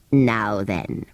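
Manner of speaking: very low sand fairy; low, settling creature. A narrator's reading. Psammead voice.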